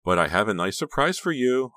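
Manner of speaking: gleeful, high-pitched teasing; high, gleeful lilt; giggly. A narrator's reading. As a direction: dangle the surprise with giggly excitement. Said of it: "Honey said, giggling".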